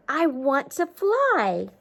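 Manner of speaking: mild mock shout, slow. Bee declaring he wants to fly, mild storybook shout. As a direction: call it out slowly, a mild shout. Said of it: "Buzzy shouted".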